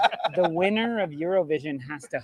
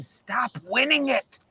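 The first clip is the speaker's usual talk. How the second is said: pleading official; 6 semitones higher, 3 dB louder than usual. Retold or imitated. Voicing Ireland's country pleading.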